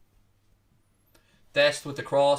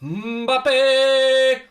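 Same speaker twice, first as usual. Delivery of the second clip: high, loud, long held cry of the name; hopeful shout; 9 semitones higher, 6 dB louder than usual. Pretend. Commentator shouts his wish signing to viewers, loud. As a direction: belt out the dream signing. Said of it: "You know I'm going to say the word".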